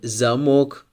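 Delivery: "замок" as za-MOK, second syllable stressed. Shown as a demonstration. Teacher models the word for lock, "замок" stressed za-MOK. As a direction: hit the full O in "замок": za-MOK. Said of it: "we have the stress on the letter O".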